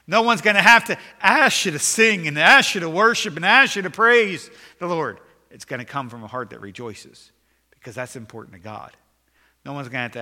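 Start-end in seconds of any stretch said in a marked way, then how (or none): 0.1-5.2 a fiery preacher's crescendo; preaching shout. Real, not acted. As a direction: shout it high and loud, at full force.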